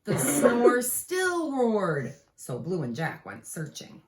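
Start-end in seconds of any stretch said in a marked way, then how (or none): 0.0-2.1 slow, loud and drawn out; big slow storybook line; dramatic slow refrain. A narrator's reading. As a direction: stretch every word.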